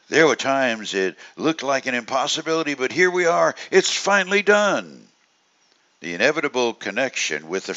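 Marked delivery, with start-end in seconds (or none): 0.1-5.0 acted relief that it's over; relieved sigh of accomplishment. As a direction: voice the group's relief, lifting to the last words. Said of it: "There's a collective group sigh".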